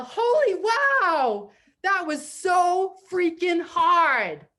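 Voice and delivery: loud, high, drawn-out cheer; hammed-up shout of relief; jubilant yell. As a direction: yell it slowly, stretching the big words. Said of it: "jump up and down and yell".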